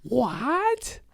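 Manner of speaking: squeaked What, high and rising; mock-innocent high squeak. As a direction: feign confusion up high. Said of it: "And I was like".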